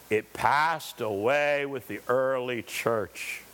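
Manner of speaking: low, slow, drawn-out mock voice; mocking skeptics. Preacher mocking those who say miracles ended, low and slow. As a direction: sneer it out like a doubter. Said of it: "And they say".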